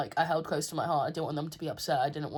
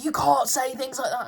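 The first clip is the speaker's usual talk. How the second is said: mock bark; 7 semitones higher, 6 dB louder than usual. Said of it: "barking at me".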